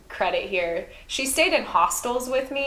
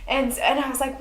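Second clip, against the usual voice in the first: normal talking voice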